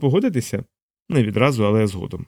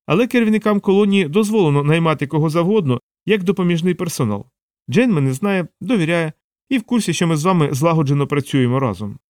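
higher-pitched voice of a reasoning assistant; Savitri, sharp-witted assistant; 5 semitones higher than usual. Acted. Assistant explaining her hiring to her boss, reasoned higher voice. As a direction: pitch it up, laying out the logic.

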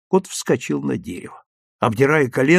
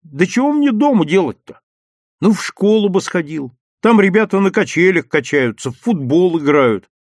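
raised, louder domestic bickering; lightly acted family squabble; nagging argument; 6 semitones higher, 6 dB louder than usual. A narrator's reading. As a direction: argue back with exasperation. Mother scolds, son argues back.

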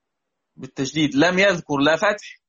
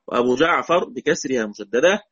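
ordinary talk

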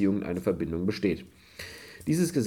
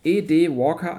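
ordinary talk

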